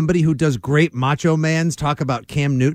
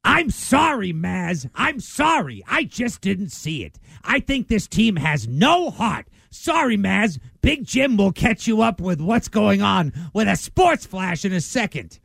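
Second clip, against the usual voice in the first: higher-pitched, slow put-on sports pundit; hardo analyst; 3 semitones higher, slower than usual. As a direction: play a self-righteous analyst, drawing out the words. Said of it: "analysis of one of these hardo an-, analysts".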